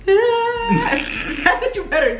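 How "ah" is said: high, loud pretend cry "Ah"; high hammed-up crying sound; mock teary gasp. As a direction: act out a sudden emotional gasp. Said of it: "you better go".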